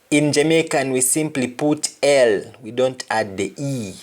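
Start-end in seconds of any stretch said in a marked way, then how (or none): none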